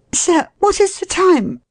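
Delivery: very high woman's questioning voice; timid lady. A narrator's reading. Lost woman asks the man the time, timid. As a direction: ask politely in a high, anxious voice.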